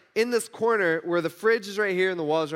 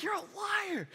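very high squeaky outburst, softer voice; mock accusation; 7 dB quieter, slower than usual. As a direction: squeal it in hammed-up indignation. Acted accusation of his son.

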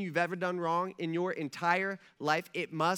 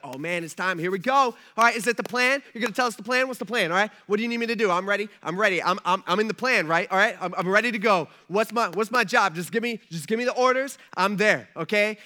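eager, loud rush of excitement; like eager recruits jostling for orders; giddy angels; 6 dB louder, faster than usual. Acted. Angels hearing it's time for the plan, giddy. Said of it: "angels are getting all giddy and stuff".

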